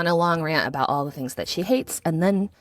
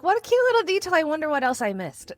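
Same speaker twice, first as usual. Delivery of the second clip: high-pitched acted delight; delighted reaction; 10 semitones higher, faster than usual. Viewer recalling spotting a cute movie detail, acted-out delight. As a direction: coo it high, like reliving a happy discovery.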